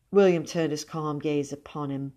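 plain reading voice

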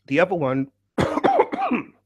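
coughing through a blocked throat; croaky throat breaking off mid-line; frog in throat. Real, not acted. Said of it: "It's like a really bad frog in my throat".

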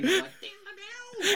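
high, slow sung guitar riff imitation; guitar riff noise. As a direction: mimic a shredding guitar with your voice. Imitating guitar shredding sound.

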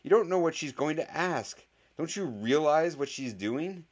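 high, frantic pleading; wailing. A narrator's reading. Boy warns voters about rival's scheme, wailing. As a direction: plead in rising panic. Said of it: "wailed Harry Potter".

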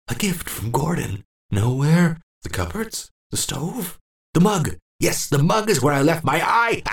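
high, unhinged glee; old man delighted to find his eye; confused old man. Acted. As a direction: cackle at the discovery. Old man finds eye, cackles.

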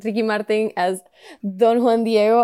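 name in a Spanish accent. Real, not acted. Podcaster introducing the cast, Spanish accent on the names. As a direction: roll it out with Spanish pronunciation. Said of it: "[name]'s name in an accent before".

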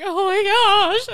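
high, loud, drawn-out wail; mock a girl making a huge fuss; stretched, hammed-up outrage; overdramatic girl. Imitating the overdramatic hurt girl.

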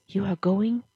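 breathless whispered question; stunned wife whispering back to her husband; shocked whisper. A narrator's reading. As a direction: whisper it in disbelief.

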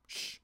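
soft unvoiced shh; hushing shh. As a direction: shush someone who keeps talking. Anton hushes again.

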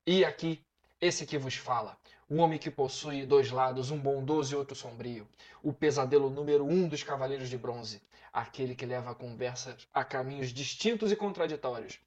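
grand introduction, drawing out the name; dramatic announcer intro. Acted. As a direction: build up each name like a show host.

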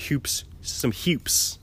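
hoops said as "hyoops"; accented hoops. Real, not acted. Joking about basketball humor, hoops drawn out as "hyoops". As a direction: slip a y-glide into hoops, "hyoops". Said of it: "I just say hoops instead of".

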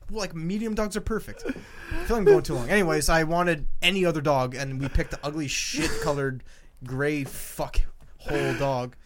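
usual speaking voice

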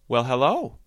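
cheerful bunny saying hello with a smile; smiling hello. A narrator's reading. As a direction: greet her with a big grin. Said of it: "he said with a big smile".

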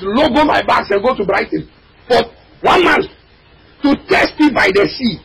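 fiery preaching. Acted. Preacher crying out to the congregation, fiery. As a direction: preach it out, voice cracking high.

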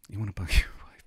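whispered with a giggle in it. Shown as a demonstration. Teasing viewers about bugging his wife, whispered. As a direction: breathe it out like a secret joke.

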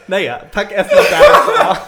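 high, loud laugh while speaking. Real, not acted. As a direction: laugh through the words slowly. Laughing embarrassed.

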